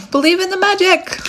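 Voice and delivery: high-pitched giddy call; silly, exuberant proclamation; playful exclamation. Acted. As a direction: call it out high, with a laugh behind it. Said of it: "That's my type of crazy".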